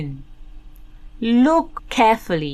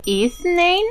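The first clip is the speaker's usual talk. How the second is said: put-on broad singsong kids theatre; 8 semitones higher, much faster than usual. Counting aloud to kids.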